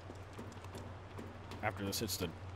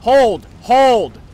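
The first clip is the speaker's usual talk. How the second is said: tense shouting; 5 semitones higher, 11 dB louder, much slower than usual. Real, not acted. Hiding then fleeing enemy.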